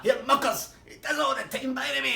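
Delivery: Swally hollering across the pub that it's nothing to worry about; loud high call. Retold or imitated. Swally calls out to the couple.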